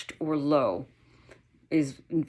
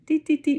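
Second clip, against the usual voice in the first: high singsong dee dee dee; playful dogs sizing each other up; 11 semitones higher than usual. Retold or imitated.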